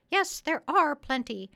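high, loud parrot voice; bossy parrot. Acted. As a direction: urge the doctor on in a high, insistent parrot voice. Polynesia the parrot answers.

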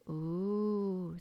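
drawn-out, level mock moan; long moan. A narrator's reading. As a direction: moan along in sympathy, half-felt. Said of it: "they all moaned".

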